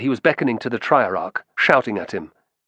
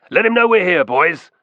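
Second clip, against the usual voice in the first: higher, louder rally call to the troops; rousing shout; 7 semitones higher, 5 dB louder than usual. A narrator's reading. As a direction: shout it out to fire up the men. Optio calling his men to cheer.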